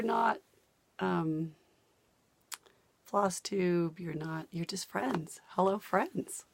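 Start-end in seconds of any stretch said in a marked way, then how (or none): none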